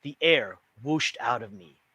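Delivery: usual speaking voice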